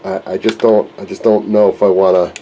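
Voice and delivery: ordinary talk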